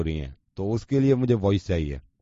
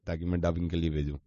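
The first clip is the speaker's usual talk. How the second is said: ordinary talk, nothing marked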